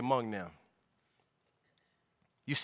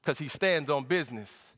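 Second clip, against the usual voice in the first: normal talking voice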